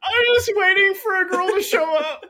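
high, loud, hammed-up wail; crying. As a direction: wail it through mock tears. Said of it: "He's just, like, crying".

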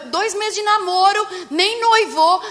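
high, loud incredulous repetition; indignant emphasis. Real, not acted. Preacher scoffs at couples dating two months, indignant. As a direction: punch it out high, in disbelief.

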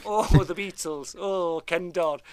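loud, hammed-up Scouse impression; Scouse accent. Showing off a comic Scouse accent. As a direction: ham up the Scouse. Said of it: "that's my Scouse accent".

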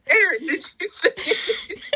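high, slow quote broken by laughter; can barely get the quote out for laughing; giggling mock question; laughing through a quote. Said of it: "And Zach's like".

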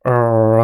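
"あー" as a long curled-tongue R sound; needlessly rolled tongue. Shown as a demonstration. Mimicking Japanese speakers' English fillers, over-rolled "あー". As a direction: draw out "あー" with the tongue curled back. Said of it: "無駄に巻き舌になってる".

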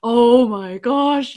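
slow, loud, drawn-out oh my gosh; shocked gasp. Retold or imitated. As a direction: gasp at the twist you read as a kid. Reacting to shocking story ending.

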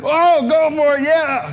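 high, loud crowd cheer; eager crowd. Retold or imitated. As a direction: cheer him on. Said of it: "And they said".

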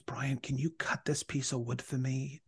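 breathy unvoiced whisper, asking a favor; whispered request. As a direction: whisper the neighbor's plea softly. Neighbor's request voiced in story.

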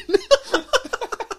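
real high-pitched burst of laughter; high laughing fit. Real, not acted. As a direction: crack up at your own joke. Laughs at own joke.

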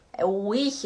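ordinary delivery

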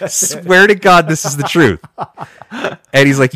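real amused protest; laughing. Real, not acted. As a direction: insist it's true while cracking up. Still laughing after the punchline.

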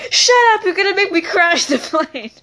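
put-on play-angry kid scolding; mock angry. Pilot snaps at laughing kids.

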